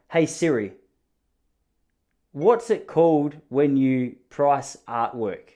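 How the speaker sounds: slow, careful voice-assistant speech; slow, deliberate command to Siri; talking to Siri. Real, not acted. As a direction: spell it out slowly for the phone. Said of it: "Don't judge the way that I speak to Siri".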